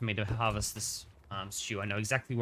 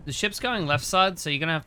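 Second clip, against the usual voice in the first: calling out; 6 semitones higher, 5 dB louder than usual. Real, not acted. Calling directions to crew.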